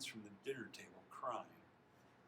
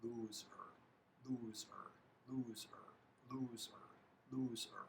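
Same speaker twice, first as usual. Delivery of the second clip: some whispering, some aloud; 5 dB quieter than usual. A narrator's reading. Teammates file by the loser, pronouncing it quietly. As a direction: drop each "loser" distinctly and softly. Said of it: "Each pronounces it perfectly".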